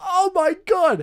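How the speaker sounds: slow, high, loud wail; hammed-up dread. Player watches minotaur keep coming, hammed-up dread. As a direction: draw it out in mock horror.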